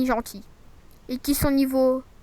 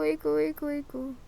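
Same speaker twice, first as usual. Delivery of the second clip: echoing words, fading softer; canyon echo; 4 dB quieter than usual. Pretend. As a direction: mimic an echo bouncing back. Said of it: "toi tu fais écho".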